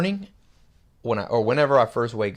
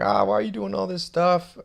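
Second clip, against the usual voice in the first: higher-pitched mock complainer; put-on high voice, nagging questions; mocking critic; 6 semitones higher than usual. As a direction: imitate a carping message from a viewer. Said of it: "Somebody wrote in".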